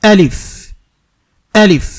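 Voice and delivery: আলিফ said firmly, short, with no drawl; firm alif. Shown as a demonstration. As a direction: say আলিফ hard with no stretching. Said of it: "শক্ত করে". (শক্ত করে টান ছাড়া আলিফ)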